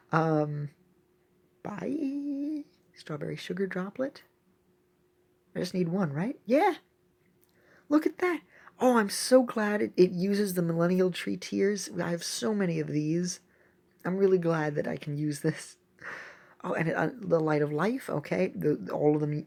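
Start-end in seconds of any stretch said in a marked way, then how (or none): none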